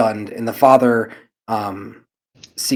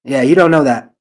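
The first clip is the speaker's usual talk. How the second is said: put-on boy's voice; 4 semitones higher, 10 dB louder than usual. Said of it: "says the other brother".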